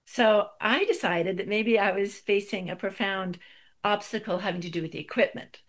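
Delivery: ordinary delivery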